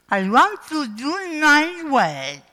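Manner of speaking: put-on slow, high-pitched English with a French accent. Preacher imitating a visiting English-speaking preacher, slow French-accented English. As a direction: draw out each English word in an imperfect French accent. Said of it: "mon accent anglais n'est pas formidable".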